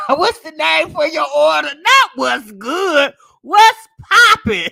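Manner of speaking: loud, rising in pitch through laughter; amused mockery. Real, not acted. Storyteller mocking a Walmart worker's greeting, laughing loudly. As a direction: crack up while mocking the greeting.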